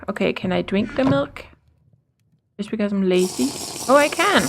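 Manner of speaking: normal talking voice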